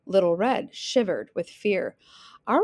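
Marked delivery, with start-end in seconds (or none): 2.5-2.6 long, rising wolf howl. Acted. Wolf has caught Little Red, long howl. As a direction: howl and hold the note.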